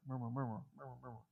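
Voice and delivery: soft mumble fading to very quiet; low murmur. Shown as a demonstration. Preacher showing a murmur reverberating through the crowd, fading murmur. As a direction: imitate a murmur dying away in a crowd. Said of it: "That little murmur".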